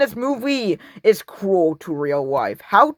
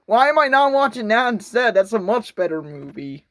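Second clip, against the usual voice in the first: normal talking voice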